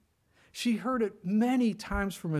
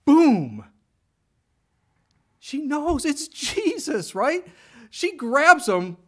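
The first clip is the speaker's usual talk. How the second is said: louder and higher, slowed for drama; dramatic burst; 10 semitones higher, 9 dB louder, much slower than usual. Real, not acted. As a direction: hit the revelation hard and slow. Dramatic climax of recognition.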